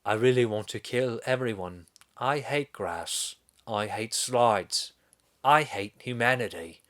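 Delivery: slow, put-on British villain; mock British accent. Killer narrating his hatred of everyone, in a mock British accent. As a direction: imitate a British killer, drawing the words out slowly. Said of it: "he has this British accent".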